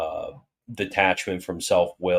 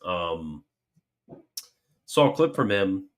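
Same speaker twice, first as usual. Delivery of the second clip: ordinary delivery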